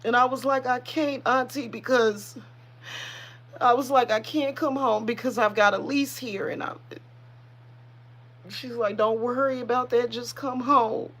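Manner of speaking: choked up, high-pitched voice; fighting back real tears while remembering a loved one; tearful. Real, not acted. Tearful memory of her auntie.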